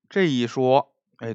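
usual speaking voice

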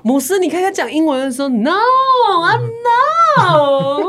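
slow, high, dramatic mock-crying voice. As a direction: ham it up like a tearful drama. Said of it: "都这么戏剧化的语调". (拖长音、带哭腔的夸张戏剧腔)

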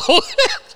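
a sudden high laugh that rises; high burst of laughter. Real, not acted. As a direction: burst out laughing at your own story. Laugh after the punchline.

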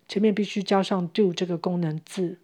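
usual speaking voice

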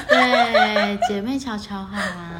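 laughing through the words, slowed. Real, not acted. Laughing as she agrees with her co-host. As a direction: laugh while naming the show. Laughing about podcast names.

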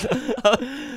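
short high rising laugh. Real, not acted. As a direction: crack up again. Laughing at own army story. (忍不住大笑)

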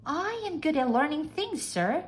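high-pitched maid's voice; high, confident maid; assuring maid. A narrator's reading. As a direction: reassure the master in a high voice. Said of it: "Nellie Mae assured him".

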